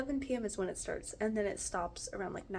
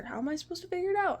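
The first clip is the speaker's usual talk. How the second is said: higher, fast exasperated question; 8 semitones higher, 4 dB louder, faster than usual. Real, not acted. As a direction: let the frustration spill out quickly. Frustrated rant about health.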